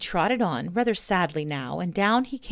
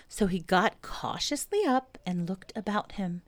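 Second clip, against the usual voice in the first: usual speaking voice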